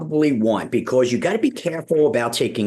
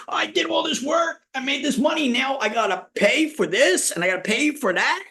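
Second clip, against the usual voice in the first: much higher whiny mock complaint; kids bitching. Kids griping to dad about paying bills, high mock whine. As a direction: whine like a kid forced to pay bills. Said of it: "we used to bitch".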